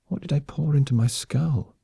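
a touch of hungover self-pity; moaning. A narrator's reading. Hungover woman wonders what she drank, mildly moaning. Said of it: "she moaned".

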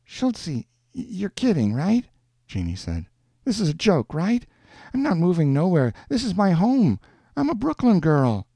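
high-pitched, disbelieving woman; incredulous Jeannie. A narrator's reading. Woman refuses to leave her home, incredulous. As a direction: push back in a high character voice. Jeannie balks at moving away.

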